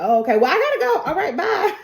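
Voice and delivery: high, loud, hurried getaway; flustered, wants out fast; awkward quick goodbye; nervous rush. Acted. Nervous exit with stolen gun.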